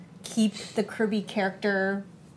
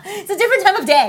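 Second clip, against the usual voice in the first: joking; 10 semitones higher, 7 dB louder than usual. Pretend. Joking about the game title.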